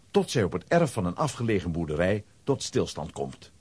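ordinary talk

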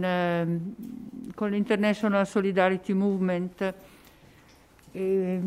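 ordinary talk, nothing marked

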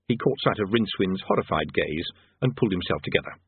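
usual speaking voice

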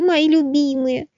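squeaky thankful delight; excited squirrel. Acted.

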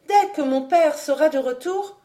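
high-pitched, affronted warning; =free outraged young lady; indignant threat. A narrator's reading. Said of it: "Alix se leva indignée".

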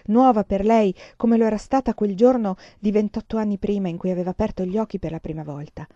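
ordinary talk

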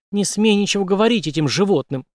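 high, angry outburst; shouted warning. A narrator's reading. Mistress forbidding talk with these animals, angry shout. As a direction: cry out to forbid him. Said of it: "неожиданно закричала его любовница".

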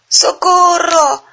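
high, slow, loud cry for help; scared shout. A narrator's reading. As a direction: shout it in panic. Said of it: "gritaron los animales".

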